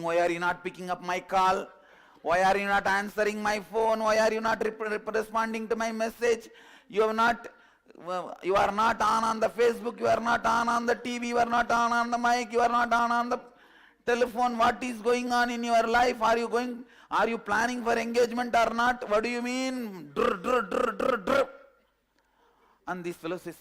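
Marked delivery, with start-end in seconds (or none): 0.0-20.1 loud, high scolding rant; angry girlfriend shouting down the phone; screaming girlfriend. Retold or imitated. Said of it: "she's screaming". 20.1-21.5 rolled drr buzzing like a ringtone; buzzing drr phone ring. Retold or imitated. Imitating a phone ringing.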